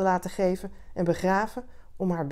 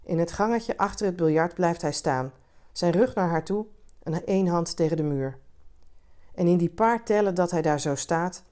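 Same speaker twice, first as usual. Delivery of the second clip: ordinary delivery, nothing marked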